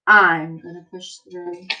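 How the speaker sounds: put-on slow, emphatic read-aloud for kids; reading to children. Teacher reading an encouraging book to her class, emphatic. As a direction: read it like a rousing picture book.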